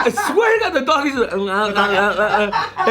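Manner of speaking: high, laughing retelling of the dog; cracking up while telling. Real, not acted. Telling friends the dog story, laughing hard.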